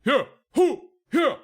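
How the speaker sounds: high, loud whoops with a laugh; whooping cheer. Acted. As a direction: whoop it up like a winner. [name] keeps celebrating.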